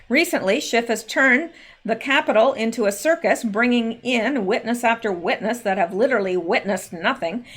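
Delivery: normal talking voice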